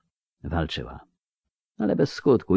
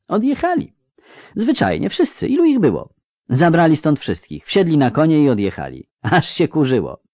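put-on much higher, excited girl's voice; chattering girl; 8 semitones higher than usual. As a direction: babble it out breathlessly. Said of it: "Ciri paplała". (paplanina Ciri, wysoko)

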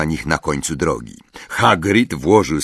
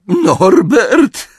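amused disbelief; 7 dB louder than usual. A narrator's reading. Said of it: "Charlie parsknął śmiechem".

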